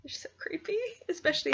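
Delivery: high whiny squeal; high, whiny, grossed-out cry; squeal of disgust. Real, not acted. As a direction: squeal it out, creeped out. Disgusted by crickets.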